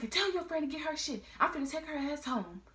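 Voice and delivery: high, fast, bossy mom voice; imitating angry mom. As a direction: rattle off orders like a fed-up mother. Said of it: "And she was like".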